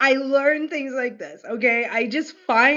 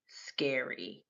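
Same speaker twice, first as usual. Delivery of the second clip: hushed whisper, lower and much softer; 9 semitones lower, 13 dB quieter than usual. Real, not acted. Streamer spooked by tiger snakes, whispering scary. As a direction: breathe the word scary.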